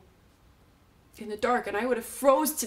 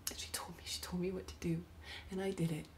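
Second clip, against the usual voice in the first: very soft, secretive near-whisper; barely voiced confession; hushed confiding; 3 semitones lower, 12 dB quieter, faster than usual. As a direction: confide it under your breath. Confiding dead dog's secret instructions.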